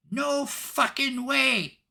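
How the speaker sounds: high, drawn-out squeal from a tiny creature; tiny little voice. Acted. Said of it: "this tiny little voice".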